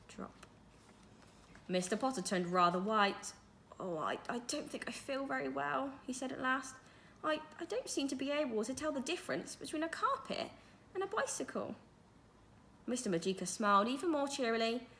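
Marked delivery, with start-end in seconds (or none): 3.8-11.7 a hint of a higher, faltering voice; a dazed teacher doubting his eyes; shaken headmaster. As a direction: stammer it out, feeling unwell. Mister Potter feeling faint.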